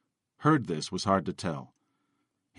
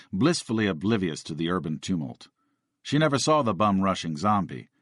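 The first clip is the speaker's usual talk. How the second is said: ordinary delivery, nothing marked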